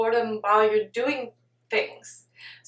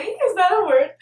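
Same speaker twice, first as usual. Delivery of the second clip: high, louder puzzled question; amused disbelief; 7 semitones higher, 6 dB louder than usual. Real, not acted. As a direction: jump up in pitch, questioning your own word. Doubts her own coined word.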